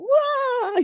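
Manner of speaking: put-on high delighted child squeal; excited squeal. Teacher imitating a little girl seeing animals, excited squeal.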